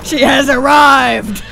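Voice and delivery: slow, loud herald's call; grand announcement. Pretend. Announcing her friend's arrival, slow booming call. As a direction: boom it out like announcing royalty.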